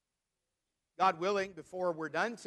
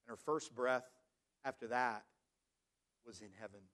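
low, soft and grave, with long pauses; hushed, solemn; 7 semitones lower, 8 dB quieter than usual. Real, not acted. Preacher telling the church of a woman's death this week, hushed and solemn. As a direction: let the voice sink for news of a death.

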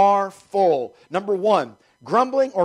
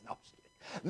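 whispered aside; 21 dB quieter, faster than usual. Real, not acted. Joking aside after the laugh.

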